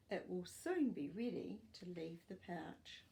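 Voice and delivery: normal talking voice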